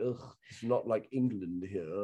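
lightly voiced sniffy tourist, soft and slow; snooty British tourist. Mocking British tourists at the drugstore, sniffy and slow. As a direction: mimic a dismissive Brit. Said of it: "British people are like".

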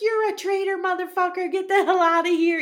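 hammed-up father throwing his son out; mock furious yell. Father flipping out at son, mock angry yell. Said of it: "Gambini flips out".

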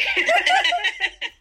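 high laugh at her own joke; giggling high laugh. Real, not acted. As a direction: burst out laughing at yourself.

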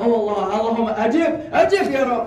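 high, comically eager supplication; naive fervour. Retold or imitated. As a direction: plead along happily, missing the insult. Naive fervent answer to curse.

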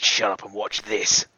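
loud, insistent boast; bragging drinker demanding attention; insistent bravado. Acted. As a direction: push it out loud and defiant. Player insists on drinking again.

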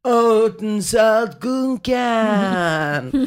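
put-on slow, slurring drunk mumble; slurred drunk order. Drunk student ordering at McDonald's, slow slurring. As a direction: mush the words like you're wasted. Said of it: "Jag sluddrar ur med min beställning".

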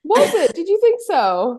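high, loud, incredulous question; surprised disbelief. Real, not acted. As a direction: react in real surprise, pitch leaping up. Reacts to criticism of accent.

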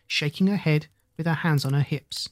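ordinary delivery

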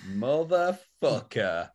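very slow, stretched-out swearing; drawn-out curse. Acted. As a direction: act out cursing someone, dragging out every syllable. Susan's reaction to Carl turning up.

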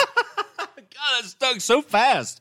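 high, loud words through laughter; laughing. Real, not acted. Friend amused that a nickname stuck, laughing hard. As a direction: crack up while slowly getting the words out.

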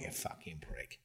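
hushed whisper under the breath; whispered insult. Retold or imitated. To himself about the sergeant, whispered curse. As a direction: hiss it so nobody hears.

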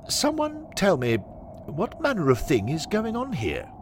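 high, lightly acted worried authority; fear under assurance. Henry faces the ghost and crowd, assured but mildly afraid. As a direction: demand answers while hiding mild alarm. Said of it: "betrays the fear he feels, despite his apparently assured outward manner".

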